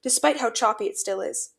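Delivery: plain reading voice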